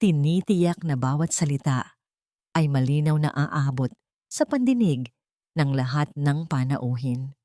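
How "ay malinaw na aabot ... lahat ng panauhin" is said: ordinary delivery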